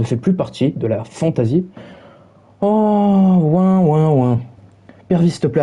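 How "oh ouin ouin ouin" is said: whiny fake boo-hoo, pitched up at the start; mock the loser's crying; a taunting imitation of sobbing. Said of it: "arrête de pleurer".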